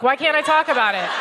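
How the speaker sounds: usual speaking voice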